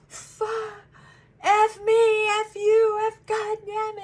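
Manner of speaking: high, loud mock cursing, trailing into a singsong; mock swearing fit. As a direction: imitate a client's cursing fit. Client melting down over broken glass.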